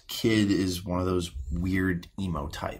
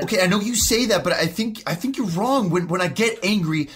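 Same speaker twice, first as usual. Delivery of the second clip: furious shouting, much higher and louder; angry outburst; 10 semitones higher, 7 dB louder than usual. Acted. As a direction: explode in rage at the therapist. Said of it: "Responding with anger is not productive".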